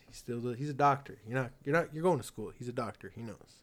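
normal talking voice

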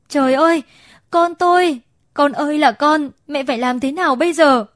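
high, loud wailing cry of a panicked mother; anguished cry. A narrator's reading. Stepmother screams over her burned baby boy, high loud anguished cry. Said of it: "Dì nó hét lên một tiếng".

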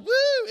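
high, loud woo; full-on worship whoop. Retold or imitated. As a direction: let out an all-in, high-pitched cheer. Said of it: "I'm, like, full on".

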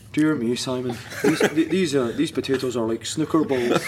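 imitated Montrose accent. Flatmate complaining about the potatoes at dinner, Montrose accent. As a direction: put on a teammate's Montrose accent. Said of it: "he had that mad Montrose accent".

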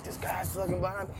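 quiet mocking mutter; muttered complaint. Girlfriend muttering to boyfriend about the guy behind her, quietly. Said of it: "talking to her boyfriend".

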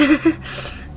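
short laugh slipping out; involuntary laugh. Acted. Narrator laughing at her own thought, an involuntary little laugh. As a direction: let a small laugh escape. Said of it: "A laugh came out from my mouth".

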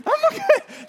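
very high mock panic; freaking-out mom. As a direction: imitate a frantic mother's squeal. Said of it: "She is freaking out".

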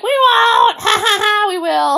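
high, loud cackling promise; mischievous kids. Retold or imitated. Kids to Jack about the boogeyman, high cackling lie. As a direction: laugh it out like naughty kids who'll do it anyway. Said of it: "then they're like".

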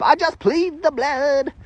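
high, slow mock pious voice; mock churchy plea. Preacher mocking what churchgoers say, mock pious plea. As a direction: lightly mimic a churchgoer's stock phrase. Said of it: "It's religious church jargon".

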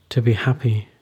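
ordinary delivery

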